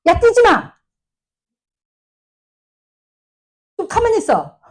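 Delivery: a stressed parent yelling across a hospital lobby. Retold or imitated. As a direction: bark at the kids to stop running. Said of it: "어떻게 하냐면".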